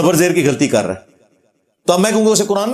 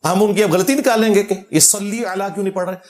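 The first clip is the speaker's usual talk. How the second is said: ordinary talk, nothing marked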